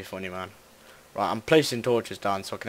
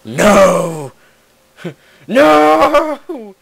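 rising yells, louder each time; yelling; 6 semitones higher, 15 dB louder, much slower than usual. Real, not acted. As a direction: scream it in rising panic. Falls in the game.

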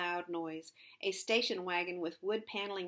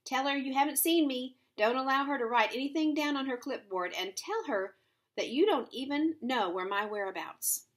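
raised, louder, urgent orders; emphatic, higher-pitched calling; shouted instructions; 6 semitones higher, 6 dB louder than usual. A narrator's reading. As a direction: call out orders to two girls. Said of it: "she shouted at Ramie and Beverly".

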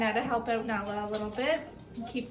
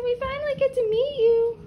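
high, louder puppy voice; voicing the puppies; 10 semitones higher, 7 dB louder than usual. Owner speaking for the puppies meeting the dogs, high. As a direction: squeak it out for the puppies.

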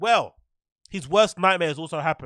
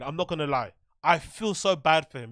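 usual speaking voice

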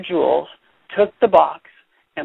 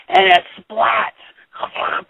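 1 dramatic whispered splat; 6 semitones higher, much slower than usual. Acted. Dramatic computer crash.

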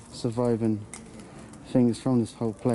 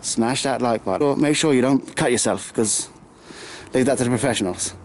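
louder, hammed-up call to smash the like button; mock YouTuber outro; 5 dB louder than usual. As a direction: parody the stock vlogger warning to viewers. Parody like-button plea and warning.

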